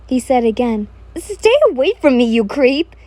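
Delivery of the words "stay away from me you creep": flustered rejection. A narrator's reading. You flailing and blushing at Kojo, flustered rejection. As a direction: fend them off in flustered alarm. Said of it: "flailing and blushing".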